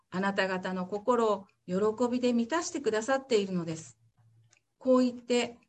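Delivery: measured Bible reading for the congregation; formal church reading; scripture reading. Acted. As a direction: read the passage clearly and steadily. Reads Acts passage aloud.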